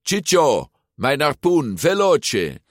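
high, urgent yelled order; yelling. A narrator's reading. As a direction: shout it downhill to a henchman. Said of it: "Ercole gilde heuvelafwaarts". (gillend bevel)

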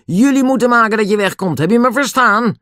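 high, loud angry order; furious shout. A narrator's reading. Tijger Dan orders the children off, furious shouting. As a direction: shout them away in fury. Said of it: "schreeuwde Dan woedend".

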